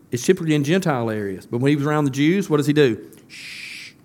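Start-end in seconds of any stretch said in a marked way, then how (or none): 3.3-3.9 unvoiced shh; whispered shh to keep it quiet; hushing. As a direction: hush them like a secret. Jesus hushing the crowd.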